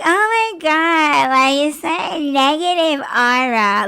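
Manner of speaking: Gen Z talk. Retold or imitated. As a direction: imitate an over-dramatic Gen Z kid. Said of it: "how fucking Gen Zs talk".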